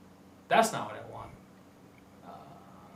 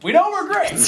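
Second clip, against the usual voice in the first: excited; 7 dB louder than usual. Real, not acted. Joking defiance over bad audio.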